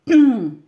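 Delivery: sharp loud cough; choking cough. Real, not acted. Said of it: "je m'étoufferai avec vous".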